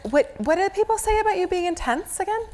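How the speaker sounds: teasing. Real, not acted. Teasing question to client.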